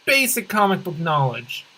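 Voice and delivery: ordinary delivery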